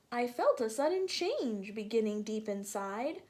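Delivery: usual speaking voice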